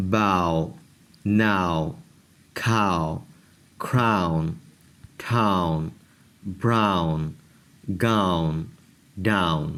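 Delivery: براؤن said braʊn with a clear au glide; careful word by word repeat; teacher repeating the ow words; au sound demo. Shown as a demonstration. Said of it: "آؤ کی آواز ہوگی".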